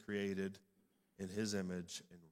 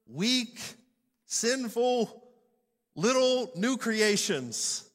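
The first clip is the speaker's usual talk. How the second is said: each word higher and louder, climbing to a peak; climactic preaching; 11 semitones higher, 11 dB louder, slower than usual. Real, not acted. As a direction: hammer the list home, rising and louder each time, slow at the end. Preacher climbs through list of words.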